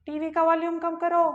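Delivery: high-pitched, loud mock mom voice; imitated mother. Mother telling child to turn the TV down, shrill high voice. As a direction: jump way up high like mom calling out. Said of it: "और mummy क्या बोलेगी".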